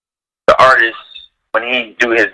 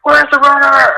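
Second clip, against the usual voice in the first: high, frantic mock shout; frantic yell; 3 dB louder than usual. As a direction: demand the runner in a high panic.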